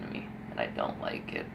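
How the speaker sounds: trailing off into a whisper; breathy, barely voiced aside. Real, not acted. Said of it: "voice fading to nothing".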